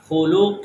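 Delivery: خلق with a full heavy qaf. Shown as a demonstration. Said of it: "قاف کو ہم نے پر پڑھنا ہے".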